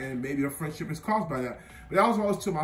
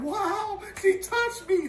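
mock starstruck awe; slower than usual. Mocking his younger starstruck self.

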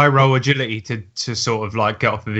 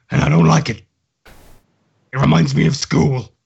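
voice changer. Acted. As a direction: speak through a voice changer, lowered. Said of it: "activate my voice changer".

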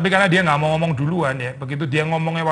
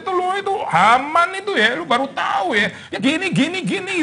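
much higher, heated mock outburst; passionate complaint; 12 semitones higher than usual. Esther blurting out her anger to the king, heated and high. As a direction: blurt it out in frustration. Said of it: "langsung passionate ngomong kekesalan kita".

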